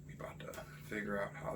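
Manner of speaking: breathy hushed whisper. Real, not acted. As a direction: whisper it like a secret aside. Hushed aside before the challenge.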